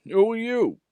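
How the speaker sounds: high, loud, other voice; haughty big engine. A narrator's reading. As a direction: ask back haughtily. Gordon replying.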